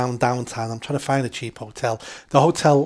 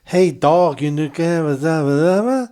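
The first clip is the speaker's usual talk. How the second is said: loud, higher, garbled street shout; imitated street stranger; 4 semitones higher, 6 dB louder, slower than usual. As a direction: mimic a man calling out in unintelligible slang. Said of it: "I heard some guy behind me go".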